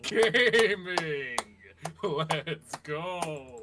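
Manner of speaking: loud, pumped-up cheer; hype shout. Real, not acted. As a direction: yell it to hype the table. Hype shout after a player's intro.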